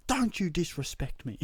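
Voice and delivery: high, loud mock-outraged yell; hammed-up, indignant shout at a cat; retold yell. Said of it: "I think I yelled at".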